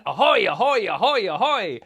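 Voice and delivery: loud, bouncing run of ahoys; repeated pirate hail. Shown as a demonstration. Pirate saying the word again for children, loud rolling hail. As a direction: call it over and over, loudly.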